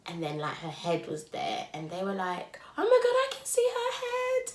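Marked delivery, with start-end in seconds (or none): 2.8-4.5 high, excited staff voice. Retold or imitated. Staff seeing the head.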